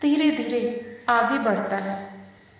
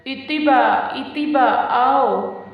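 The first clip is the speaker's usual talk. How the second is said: put-on toddler calling; 3 semitones higher, 7 dB louder, slower than usual. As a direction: call out like a two-year-old wanting her nanny. Toddler reaching arms to nanny.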